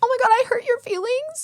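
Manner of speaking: high-pitched fake concern; exaggerated high-voiced pity; mock sympathy. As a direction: coo it high, sarcastically sorry. Mock sympathy to offended internet people.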